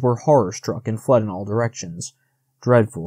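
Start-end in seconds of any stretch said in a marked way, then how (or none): none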